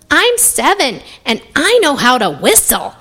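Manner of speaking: put-on high, eager seven-year-old; high-pitched boy showing off; little boy voice. A narrator's reading. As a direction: pipe up proudly like a small child. Young boy character speaks up.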